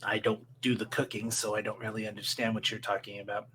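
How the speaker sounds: soft, low gaunt undertaker; low, quiet mortician. Acted. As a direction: murmur low like a dry mortician. GM voices the mortician.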